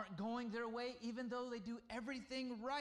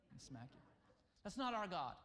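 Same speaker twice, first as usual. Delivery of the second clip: normal talking voice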